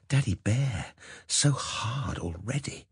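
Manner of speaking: slow seductive whisper; breathy whisper. Acted. Heroine whispers during seduction.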